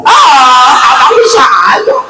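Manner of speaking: high, giggling coy wife; flattered wife. Pretend. Wife flattered by her husband's compliment, high with a giggle. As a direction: laugh it off, flattered and shy.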